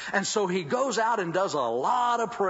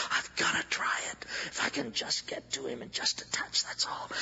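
unvoiced, breathy whisper of resolve; barely breathing the words, desperate; 2 semitones lower, 4 dB quieter than usual. The outcast woman whispering her resolve to herself.

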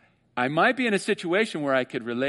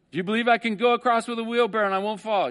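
higher, louder acrobat daring the crowd; showman's challenge; 8 semitones higher, 4 dB louder, faster than usual. Retold or imitated. Acrobat daring the crowd to get in, higher and louder. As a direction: dare them, much higher and louder.

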